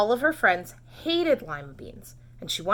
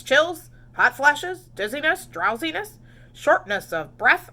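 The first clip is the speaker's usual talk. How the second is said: loud, bouncy checklist of symptoms; hearty doctor; 5 semitones higher, 7 dB louder than usual. Acted. Doctor questioning Camilla, loud lively symptom list. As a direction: rattle off the list like a busy doctor.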